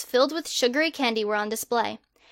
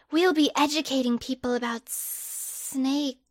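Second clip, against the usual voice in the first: put-on creepy snake boy; 2 semitones higher, slower than usual. Slytherin boy slowly pitching his snake survey, slow and eerie. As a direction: draw each word out like a creeping snake.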